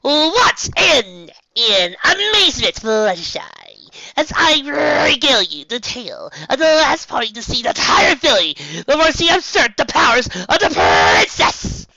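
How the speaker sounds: loud, grand stage performer voice; boastful showmare. Acted. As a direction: proclaim it like a boastful magician. Trixie's grand boastful storytelling.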